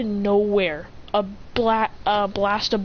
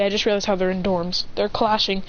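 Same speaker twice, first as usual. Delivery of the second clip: plain reading voice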